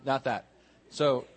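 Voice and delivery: usual speaking voice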